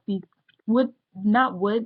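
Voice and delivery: ordinary talk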